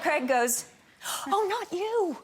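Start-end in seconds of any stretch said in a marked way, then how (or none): 1.3-2.2 laughing correction. Retold or imitated. Craig corrects her, laughing.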